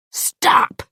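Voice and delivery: loud one-word command; shout. A narrator's reading. Man pushing Louise away by the shoulders, shouting. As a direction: shout it to halt someone. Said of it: "and he shouts".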